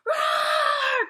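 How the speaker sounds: loud, high, drawn-out roar; mad bear roar. A narrator's reading. Grumpy bear can take it no longer, mad roar. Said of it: "'cause he's so mad".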